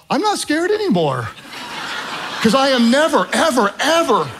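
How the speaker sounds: high, emphatic comic declaration; triumphant relief. Retold or imitated. Nervous student pilot realising he is safe, emphatic relief. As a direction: pitch it high and hammer each "ever".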